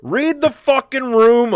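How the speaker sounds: high, loud, drawn-out scolding; mock exasperated yell. Comedian scolding an absent O J, mock exasperated yell. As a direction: yell it slowly in comic disbelief.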